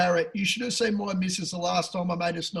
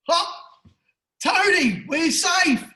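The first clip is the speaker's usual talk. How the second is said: high, loud cheer of relief; excited shout; 10 semitones higher, 5 dB louder, slower than usual. Real, not acted. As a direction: yell it out like the horse just got you home. Winning tip starts with S.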